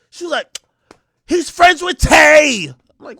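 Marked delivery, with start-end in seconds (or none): 1.3-2.7 high, loud, drawn-out mimicry; insistent date. Date explaining who the man is, insistent mimicry.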